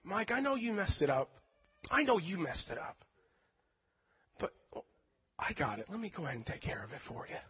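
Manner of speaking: soft, gentle reassurance; God gently telling Mike he has it handled; God's tender voice. Retold or imitated. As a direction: speak as a loving father. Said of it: "I feel that same way with God".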